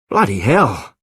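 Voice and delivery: startled curse, BLOODY hit hardest; exclaiming. Acted. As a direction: blurt the oath out in surprise. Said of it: "he exclaimed".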